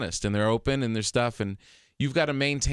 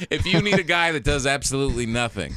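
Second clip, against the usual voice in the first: laughing; 4 semitones higher, 5 dB louder than usual. Real, not acted. Joking pitch, laughing.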